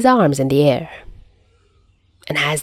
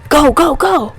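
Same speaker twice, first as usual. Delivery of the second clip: high urgent shout; yelling go; 8 semitones higher, 3 dB louder than usual. Acted. Rider behind Chuya urging him to speed off, high yell. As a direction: hurry the driver on.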